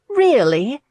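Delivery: high, loud delighted question; beaming. A narrator's reading. Abby hears her friend needs a seamstress, beaming. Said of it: "Abby beamed".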